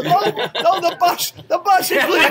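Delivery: high-pitched mock panic of old folks; fretting elderly audience. Elderly audience fleeing the comic's show for their bus, mock panic.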